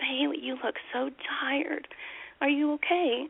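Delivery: put-on high, hushed concern for a tired child; worried mommy voice. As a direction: gently check on her little girl. Mother worried about tired child.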